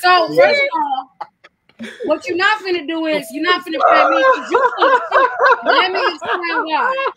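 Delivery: loud laughing rant. Real, not acted. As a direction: laugh and shout him down as full of shit.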